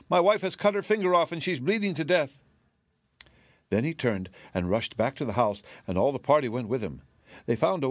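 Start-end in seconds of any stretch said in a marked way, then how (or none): 0.1-2.4 high, frantic report; screamed in panic. A narrator's reading. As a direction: cry out an emergency. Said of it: "screamed the man".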